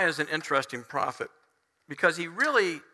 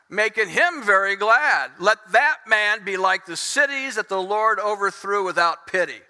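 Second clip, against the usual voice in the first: loud, emphatic declaimed scripture; bitter prophetic oration; harsh curse; 5 dB louder than usual. Acted. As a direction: pronounce the curse forcefully. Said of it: "That's kind of harsh".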